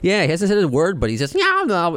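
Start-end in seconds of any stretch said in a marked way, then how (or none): 1.3-1.9 very high creature squawk; imitated baby babble, pitched way up; gremlin-like baby voice. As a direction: squeak it like a little gremlin. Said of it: "He sounds like a gremlin".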